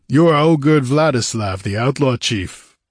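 name him with some force; defiant captive. A narrator's reading. Crucified warrior identifying the outlaw chief, defiant.

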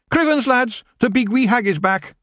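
high rough Scottish pixie; broad Scottish wee man; Scottish accent. Acted. Feagle alarmed cry.

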